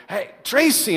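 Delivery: mock emphatic call across the house. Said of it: "I'll say it louder with a little more emphasis".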